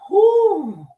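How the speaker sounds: high, loud whoop of release; spirit-filled whoop; ecstatic whoo. Retold or imitated. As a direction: let out a big joyful whoo. Overcome by ancestors' presence.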